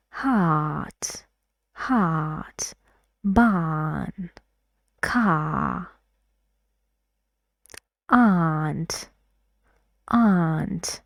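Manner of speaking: heart, barn, car and aunt with a long open back ah; British broad a. Shown as a demonstration. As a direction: drop the middle of the tongue for every ah. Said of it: "باید بیاد پایین تر یکم".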